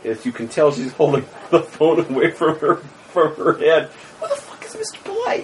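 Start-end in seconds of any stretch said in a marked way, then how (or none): 4.2-5.4 high, laughing shriek; shrieking confusion. Acted. GM voices the baffled woman, high laughing shriek. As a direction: squeal it out in disbelief.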